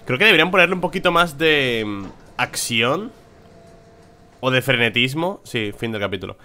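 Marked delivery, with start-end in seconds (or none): none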